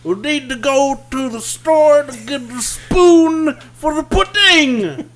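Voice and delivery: high, loud, slow laughing yell; Bill Cosby impression. Podcast host does a sitcom dad yelling up the stairs. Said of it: "I've got Bill Cosby".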